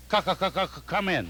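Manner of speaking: stammering on the first sound, c- c- c- c- then come in; stutter. Acted. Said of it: "but he stutters".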